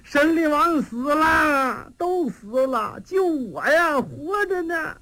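crying to his sworn brother that everyone died; weeping. Acted. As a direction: draw each word out through the tears. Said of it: "贾明又哭上了".

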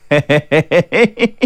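dry, sly staccato chuckle; villain's chuckle. A narrator's reading. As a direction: chuckle slyly in short, even bursts. Villain chuckles before his question.

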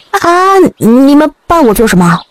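high-pitched startled exclamation; astonished. A narrator's reading. Man asks bowing women officers why, astonished. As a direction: blurt it out, taken aback. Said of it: "于小李讶异".

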